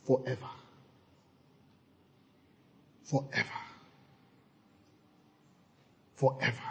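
very soft, unvoiced; hushed forever. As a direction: breathe forever out. Whispered consecration refrain.

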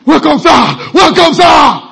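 loud, eager welcome; eager host. Retold or imitated. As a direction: greet the guest with gusto. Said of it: "Imagine a wealthy man saying".

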